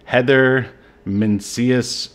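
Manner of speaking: ordinary talk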